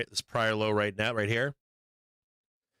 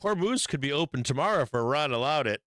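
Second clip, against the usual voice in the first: usual speaking voice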